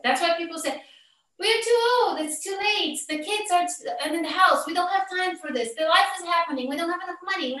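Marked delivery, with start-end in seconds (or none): 1.4-7.6 high-pitched, mock-helpless list of excuses; whiny excuses. Coach mimicking couples who avoid the work, mock complaining. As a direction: reel off objections in a raised, complaining voice.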